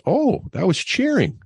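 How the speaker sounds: put-on high, loud child's voice; son's realization. Little son realizes at dinner, high childlike voice. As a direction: play the little boy getting it. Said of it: "he goes".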